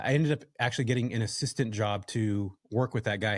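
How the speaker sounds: normal talking voice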